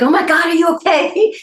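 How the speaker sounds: mother's panicked high cry; mom. Retold or imitated. Mother fretting to her daughter, panicked high cry.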